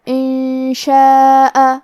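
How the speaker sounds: انشاء very slow, long held alif; four-count madd. Shown as a demonstration. Teacher holds madd muttasil in انشاء for students. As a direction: stretch شاء four counts. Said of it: "مد واجب متصل ہے".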